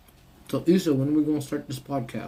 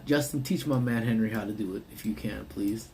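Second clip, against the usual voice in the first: ordinary delivery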